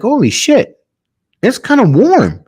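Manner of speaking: very high-pitched incredulous reaction; acted amazement. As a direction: act out his startled discovery. Pilot finds windscreen warm.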